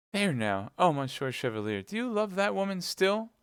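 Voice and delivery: put-on high, plaintive young woman; Kitty, upset. A narrator's reading. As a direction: rise to a high, wounded question.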